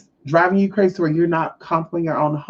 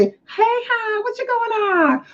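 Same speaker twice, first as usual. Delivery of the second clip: fake friendly. Playing the role for a neighbour, fake friendly. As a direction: mimic an over-sweet hello way up high. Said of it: "You know, you do it. Say".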